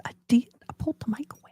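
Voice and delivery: soft breathy whisper. Real, not acted. Streamer whispering an apology to Ronnie with a headache. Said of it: "I'll start talking like this".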